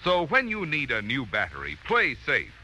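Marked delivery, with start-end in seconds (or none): none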